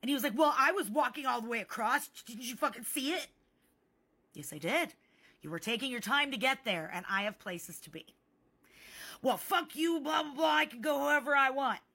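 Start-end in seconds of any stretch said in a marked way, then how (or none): 0.4-3.3 higher-pitched indignant retort; mock angry complaint; retold mad man. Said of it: "like, all mad at me". 9.2-11.8 high, loud imitation of a ranting man; hammed-up defiant outburst; mock angry rant. Acted quarrel, angry man's reply.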